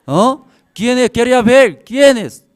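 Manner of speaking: loud, high outburst of an outraged father; retold rage. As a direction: demand the culprit, shouting in mock fury. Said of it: "me daba rabia".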